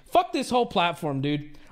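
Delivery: usual speaking voice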